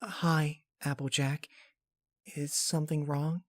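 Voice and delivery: a soft, shy little voice; Fluttershy answering shyly. Acted. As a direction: speak timidly, like you'd rather hide. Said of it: "slow and shy rhythm".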